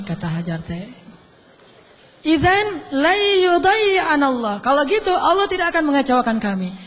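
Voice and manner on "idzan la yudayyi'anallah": higher, louder and slow, falling firmly; emphatic declaration. Retold or imitated. Hajar declaring trust in Allah, slow and emphatic. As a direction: proclaim it slowly with full conviction. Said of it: "Itu kata kuncinya tuh".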